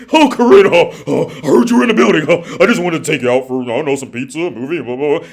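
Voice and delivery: loud, lightly voiced eager suitor asking her out. Mimicking a suitor asking Corinna out, loud and eager. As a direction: gush like a man begging for a date.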